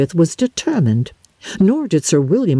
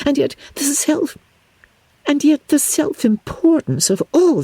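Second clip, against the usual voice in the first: higher, sneering society lady; Miss Bingley; 6 semitones higher than usual. Acted. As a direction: drip scorn on every phrase, pitched up.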